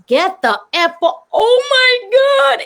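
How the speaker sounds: high mock yell breaking into a drawn-out "oh my God"; shocked outburst. Fighter turns and sees Muhammad Ali, shocked outburst. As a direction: start a threat, break into stunned high wail. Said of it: "I turn, I was".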